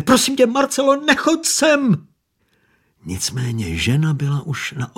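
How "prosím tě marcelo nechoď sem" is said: higher, louder desperate begging; the fat man begging Marcela to stay away, crying out; pleading cry. A narrator's reading. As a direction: plead with her at the top of your voice. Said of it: "křičel úpěnlivě".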